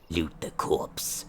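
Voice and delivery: slow whispered aside. Real, not acted. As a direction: breathe it out softly. Whispered command.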